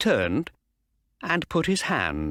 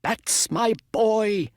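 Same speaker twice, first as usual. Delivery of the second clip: put-on high, slow, proud old owner's cheer; proud Mister Ross; 12 semitones higher, 4 dB louder, slower than usual. A narrator's reading. Owner cheering his winning horse, high and slow. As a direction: beam with pride at your boy. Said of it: "said Mister Ross".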